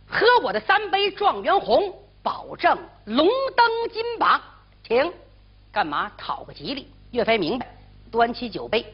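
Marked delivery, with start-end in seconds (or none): 0.1-5.1 innkeeper voice. Acted. Innkeeper toasting scholars.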